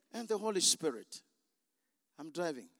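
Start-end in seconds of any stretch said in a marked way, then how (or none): none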